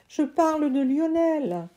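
put-on a kid pleading to be heard; boy's voice.

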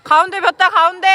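loud sideline shout; shouting to the court. Real, not acted. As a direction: yell it across the gym. Coach shouting to players.

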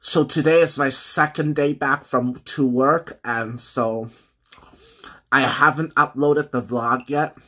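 partly full mouth; Y: talking while chewing. Real, not acted. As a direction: pizza slice at lips. Seen 1: bites at -3.0, then talks with pizza held at mouth.

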